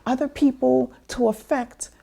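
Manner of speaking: high exasperated mother's voice; mom scolding. Retold or imitated. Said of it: "she was like".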